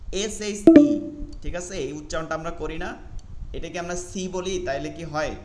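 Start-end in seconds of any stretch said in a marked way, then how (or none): 0.1-1.0 এস এইচ ই loud and high; emphatic spelling. Shown as a demonstration. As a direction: call out the letters. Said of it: "যাদের শি উচ্চারণ হয় না". (জোরে বানান করে)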